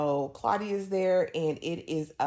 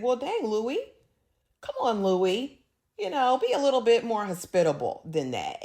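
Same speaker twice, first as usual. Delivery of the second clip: playful chiding; 5 semitones higher than usual. Retold or imitated. Viewer telling Louis to be more hospitable, playful chiding. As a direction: tease him for overreacting.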